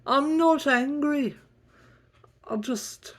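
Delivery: high, loud huffy denial; huffy high Kendall. Acted. Kendall insisting she's not angry, high and huffy. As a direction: deny being angry, then insult him. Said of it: "So angry".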